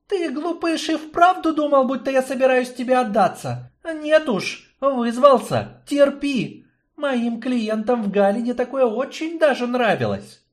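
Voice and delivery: high, rising, mocking woman's voice; taunting woman. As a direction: taunt him with relish. Yarina mocks her captive.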